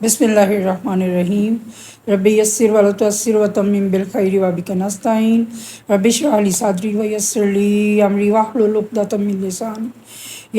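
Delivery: quiet prayerful Arabic recitation; recited prayer. Acted. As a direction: murmur the opening prayer reverently and unhurried. Opening prayer before lesson.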